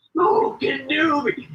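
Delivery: high, very drawn-out goofy delivery; imitated comic catchphrase. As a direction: stretch it out high like a silly bit. Imitating castmate's comic bit.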